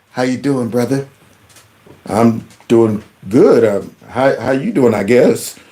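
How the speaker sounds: lightly voiced low, hesitant small talk; awkward stranger. Two strangers chatting awkwardly in a bathroom, lightly acted unease.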